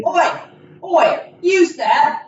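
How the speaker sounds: hammed-up Cockney customer calling out; British peasant calling out. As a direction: hail the vendor across the fair. Improvised Renaissance fair scene.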